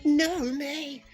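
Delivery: high squeaky silly voice. Pretend. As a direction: pop up into a squeak. Voice jumps very high.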